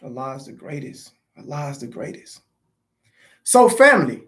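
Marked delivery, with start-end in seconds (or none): 0.0-2.4 very soft, low murmur to himself; murmured prayer. Real, not acted. Grieving father praying to himself, barely audible. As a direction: praise Allah under your breath.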